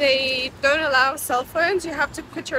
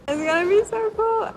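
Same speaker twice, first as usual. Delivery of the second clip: high, loud, gleeful burst; excited; 9 semitones higher, 5 dB louder than usual. Real, not acted. Looking forward to surprising mom, excited. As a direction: squeal it with rising excitement.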